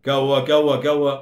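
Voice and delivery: a crowd all asking at once; crowd clamour. Acted. Passengers in the dark coach clamour at once, loud. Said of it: "सगळ्यांनी गिल्ला केला".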